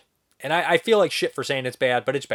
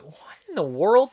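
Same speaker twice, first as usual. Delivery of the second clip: higher, drawn-out mild disbelief; mild mock bafflement; 8 semitones higher, 3 dB louder, slower than usual. Viewer reacting to a gas-masked watcher, mildly mock-baffled. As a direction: lightly act out being baffled.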